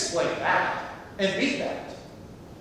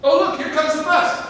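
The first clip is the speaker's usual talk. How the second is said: much higher and louder, delighted; excited announcement; 9 semitones higher, 6 dB louder than usual. Acted. To his bus-stop companion, excited about the bus. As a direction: point out the bus, thrilled.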